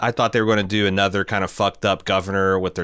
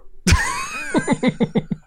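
strained laugh. Real, not acted. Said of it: "my throat's only good for one of those".

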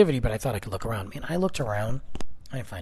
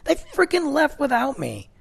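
indignant outburst; 12 semitones higher, 5 dB louder than usual. Real, not acted. Indignant rant at chaperones.

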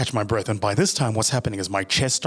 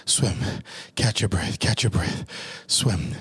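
usual speaking voice

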